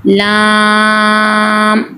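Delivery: लाम with the aa held very long; elongated madd. Shown as a demonstration. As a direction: stretch लाम on one level note before closing the m. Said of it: "लंबा किया जाता है".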